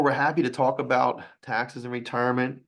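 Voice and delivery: ordinary delivery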